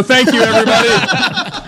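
high, laughing mock farewell. Real, not acted. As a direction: laugh through a joking goodbye.

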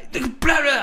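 high garbled bark; barked gibberish. Retold or imitated. Drunk guy barking at Taylor, mock barked gibberish. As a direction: mimic a drunk barking nonsense. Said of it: "barks something at Taylor, like".

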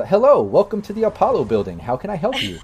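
bright, high synthetic greeting; chirpy robotic receptionist. Acted. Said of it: "and it says".

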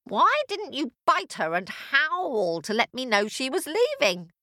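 high-pitched, indignant telling-off; scolding witch. Acted. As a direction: demand an answer crossly.

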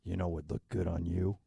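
a hint of a creepy seducer; sexy voice. Shown as a demonstration. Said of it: "here's mine".

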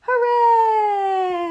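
loud high echoing cheer; shouting. Acted. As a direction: shout it back like an echo. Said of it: "the echo shouted back to them".

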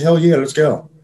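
a lifted, eager yes to beers and wings; eager hell yeah. Retold or imitated.

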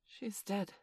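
soft, uneasy statement; uncomfortably. A narrator's reading. Peter looking at Wendy, uncomfortable. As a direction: say it hushed and awkward. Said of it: "he said uncomfortably".

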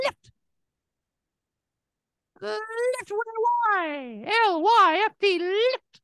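very high, strained cartoon cat squeal; a cat squeaking up high, insisting on Lyft. Role-play cat refusing the Uber, in a very high squeaky voice.

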